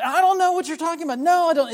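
high, loud, rapid-fire mock denial; acted-out insistent outburst of denial; going off. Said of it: "he's going off".

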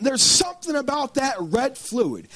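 ordinary delivery

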